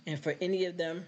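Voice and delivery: ordinary talk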